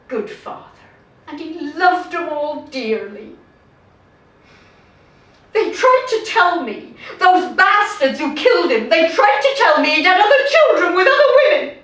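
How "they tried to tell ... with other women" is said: higher, louder, rushing into indignation; bitter outburst. Acted. Widow recalling her husband's killers' claims, bitter outburst. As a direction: spit out the hurtful accusation, building in speed.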